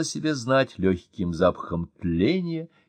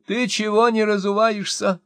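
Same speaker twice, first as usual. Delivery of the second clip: high, scolding call after him; 12 semitones higher, 3 dB louder than usual. A narrator's reading. Wife yelling after her husband, high scolding shout. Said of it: "закричала вслед мужу Мощина".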